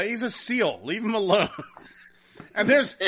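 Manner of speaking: usual speaking voice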